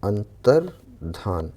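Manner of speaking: अंतर्धान said very slowly, each syllable clear, with a full धा and no या; slow careful pronunciation. Shown as a demonstration. Teacher to students, stretching out the correct अंतर्धान. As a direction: draw out अंतर्धान for the class. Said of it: "क्या होता है?".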